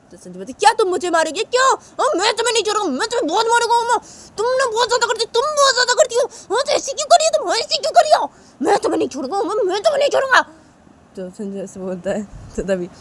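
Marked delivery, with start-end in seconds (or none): 0.5-10.4 put-on very high, loud shrieking boy; furious boy. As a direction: scream your revenge. Sanju threatening Uma. (बहुत ऊँची गुस्से भरी चीख)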